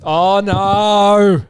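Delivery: loud, drawn-out mock dismay. Co-host hears a tipping story coming, mock dismay. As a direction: groan in exaggerated sympathy.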